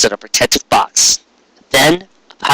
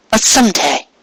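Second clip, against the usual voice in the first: put-on a shy, hopeful young mare; Cupcake's voice; 10 semitones higher than usual. Cupcake teasing promise.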